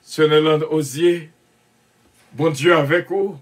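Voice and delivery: ordinary talk, nothing marked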